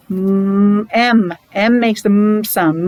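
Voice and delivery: teacher sounding out M firmly; modelled m sound. Shown as a demonstration. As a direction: hum the mm, then name M. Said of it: "teacher models letter sound".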